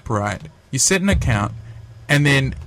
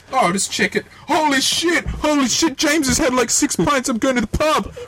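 much higher, hammed-up shocked friend at home; mock amazed friend; 10 semitones higher than usual. Friend at home reading the app, in mock high amazement. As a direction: gasp at the news like a stunned mate. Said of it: "sitting at home going".

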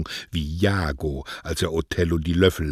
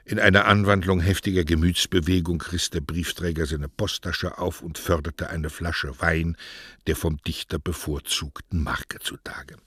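usual speaking voice

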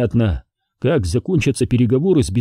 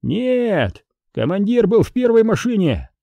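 high-pitched call without much force; 9 semitones higher than usual. A narrator's reading. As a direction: shout an answer across to the other side. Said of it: "крикнули со стороны зила". (выкрик издалека высоким голосом)

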